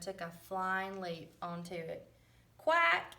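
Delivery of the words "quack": loud high quack; yelled quack. A narrator's reading. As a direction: yell a big quack like a duck. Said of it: "yelled Duck".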